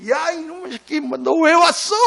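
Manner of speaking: hammed-up angry father scolding his son. Angry father voiced in story.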